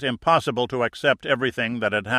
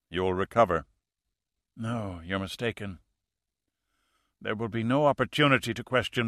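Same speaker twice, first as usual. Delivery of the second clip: a calm fanatic with little breath left; dying killer. A narrator's reading.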